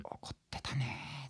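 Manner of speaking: barely audible, drawn-out near-whisper; fading, vanishing voice. Retold or imitated. Bandmate reporting the manager was angry, in a vanishing voice. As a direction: let the words slowly die away as you imitate him. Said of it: "もう消え入るような声で、".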